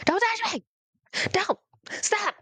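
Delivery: the panic peaks, voice way up; panicked shriek. Retold or imitated. A terrified child pleading with the church people, shrieking high.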